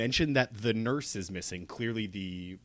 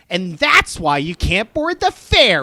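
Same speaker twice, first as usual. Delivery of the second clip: louder and higher, slowed; mock deadpan punchline; 9 semitones higher, 10 dB louder, slower than usual. Friend retelling the ferry worker's line, mock official. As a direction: deliver it as a flat official announcement. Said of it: "the other one goes".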